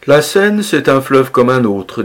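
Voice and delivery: world-weary, blasé tone; disillusioned. A narrator's reading. Blasé gentleman on tourist boat, disillusioned. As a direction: let it drop like nothing impresses you. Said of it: "dit d'une voix désabusée".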